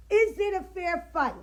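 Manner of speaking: loud indignant demand; loud challenge. Real, not acted. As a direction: press the question hard. Repeating a pointed question.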